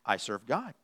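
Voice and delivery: low, soft, humble dog; quiet voice of a servant dog; devoted dog. Acted. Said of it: "A dog says".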